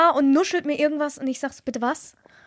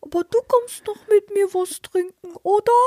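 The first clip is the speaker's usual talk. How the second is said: higher mock begging voice; puppy-eyed pleading; 5 semitones higher than usual. Friend imitating the guy's puppy-dog plea, higher pleading. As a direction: beg like a sad puppy. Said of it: "mit einem Dackelblick an und macht".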